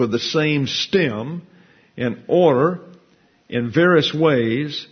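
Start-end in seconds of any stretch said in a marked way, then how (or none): none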